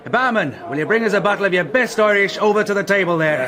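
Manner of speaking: loud, high call across a noisy bar with a laugh. Acted. Man ordering whisky from the barman, laughing loud call. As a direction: hail the barman over the din.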